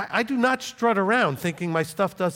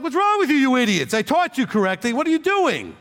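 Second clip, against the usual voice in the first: higher, louder exasperated scolding; mock-berate them in disbelief, pitch jumping up; raging Paul; 6 semitones higher, 4 dB louder than usual. Paul scolding the Galatians for straying, exasperated rant. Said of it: "you would have thought he was a raging egomaniac".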